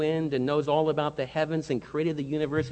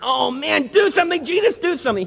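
high, loud frantic begging; panicked plea; 10 semitones higher, 6 dB louder than usual. Acted. Disciples to sleeping Jesus, frantic high plea. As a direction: beg for help as the boat sinks.

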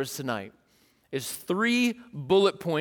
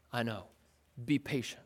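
much softer, unbothered; calm reply; 8 semitones lower, 11 dB quieter than usual. Pretend. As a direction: answer quietly, unmoved. James replies calmly.

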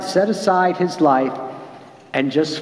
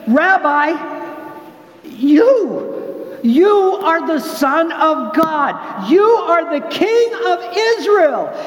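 awed declaration; 10 semitones higher, slower than usual. Retold or imitated. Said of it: "Nathanael declares".

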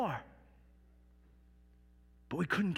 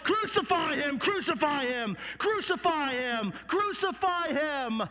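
high, insistent mob chant; raised, hammering repeated demand; crowd crying out; 8 semitones higher than usual. Acted. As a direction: cry it out as an angry crowd, stretching the last ones. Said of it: "they began to cry out".

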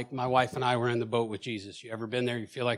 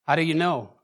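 louder, higher, pressing the question; insistent question; 4 semitones higher, 6 dB louder than usual. Retold or imitated. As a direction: demand it again, louder, almost pleading. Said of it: "she said these words".

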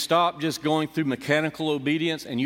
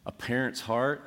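usual speaking voice

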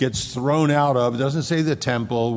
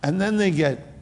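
ordinary delivery

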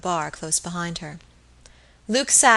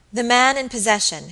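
ordinary delivery

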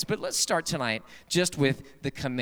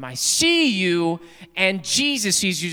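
louder slow warning; 3 semitones higher, 5 dB louder, slower than usual. Acted. Parrot's third warning.

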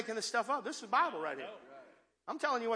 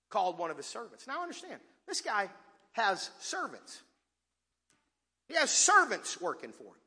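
normal talking voice

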